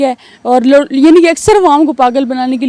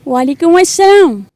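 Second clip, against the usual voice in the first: slow, girlish put-on voice answering a call; girl's voice; 3 semitones higher, slower than usual. Prank caller answering the host in a girl's voice. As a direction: play a sweet young woman picking up the phone. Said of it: "وہ ذرا سارے کا سارا کر کے تو دکھائیں".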